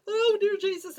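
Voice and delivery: loud, high mock-panicked plea; high desperate exclamation; panicked prayer. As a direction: cry out to heaven in mock fright. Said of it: "I'm praying at the same time".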